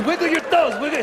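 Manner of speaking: high mock Mexican-accent command; Mexican referee. Referee ordering the injured player, high Mexican accent. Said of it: "So he said, he goes".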